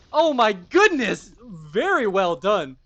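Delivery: loud, high commentator excitement; real hype, big and high-pitched; amazed outburst. Real, not acted. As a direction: exclaim it in astonishment, way up high and loud. Commentator reacts to opening handshake.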